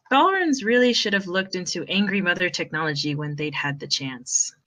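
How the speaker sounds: steady read-aloud storytelling voice; story narration. Acted. Narrator reading the fanfic chapter aloud to friends, storytelling.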